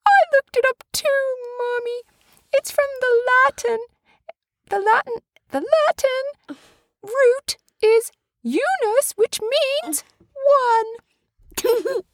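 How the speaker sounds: high squeaky puppet voice, far above her own; little Andy voice. Pretend. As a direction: play a tiny toy character.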